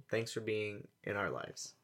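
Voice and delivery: usual speaking voice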